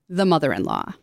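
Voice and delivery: low, ominous spooky voice. Acted. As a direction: say it like a creepy warning. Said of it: "In a spooky voice".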